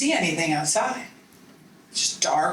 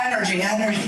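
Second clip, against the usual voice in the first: ordinary talk, nothing marked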